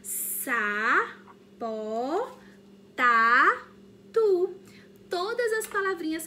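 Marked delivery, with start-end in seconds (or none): 0.0-4.6 "Sa-po-ta-tu" very slow and loud, each syllable separate; teacher chanting syllables for kids; split into syllables. Shown as a demonstration. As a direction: beat out "Sa-po-ta-tu" one syllable at a time. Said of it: "Olha só o que a tia fez".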